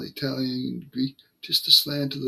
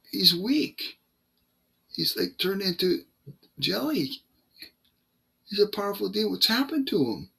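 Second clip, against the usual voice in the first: higher, alarmed voice of someone else; bewildered Satanist; 7 semitones higher than usual. Retold or imitated. Satanist tells the preacher what he sees, in a higher, alarmed voice. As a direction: voice the rattled Satanist as he reports. Satanist describing weakened demon.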